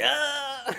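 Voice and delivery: high, loud pretend yell; hammed-up attack scream; mock scream. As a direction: cry out high as if striking, in play. Pretend attack on past self.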